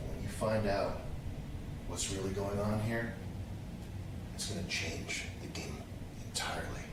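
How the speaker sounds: low, half-whispered hushed trailer read; ominous trailer voice. Shown as a demonstration. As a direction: tease the secret in a breathy hush. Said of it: "I'll do it my way".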